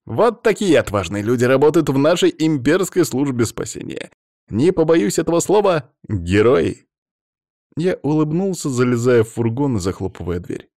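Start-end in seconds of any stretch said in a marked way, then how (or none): 0.0-6.9 raised, proclaiming on-air voice; TV reporter. A narrator's reading. Reporter turning to camera to praise rescuers, proclaiming. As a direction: build up grandly to the final word.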